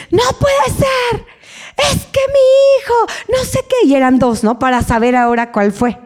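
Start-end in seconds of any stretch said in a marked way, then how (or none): 0.1-3.9 very high, exasperated mock rant; furious mother. Angry mother venting about her son at the office, high-pitched and exasperated. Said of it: "salió enojadísima y dice".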